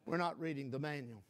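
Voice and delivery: a sober murmured echo of the point. Shown as a demonstration. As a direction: drop the voice and lean on not. Repeated low and soft.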